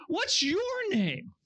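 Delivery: slow, high, drawn-out ask; slowed awed asking; puzzled question. Acted. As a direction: stretch the question out in wonder. Moses asking God again.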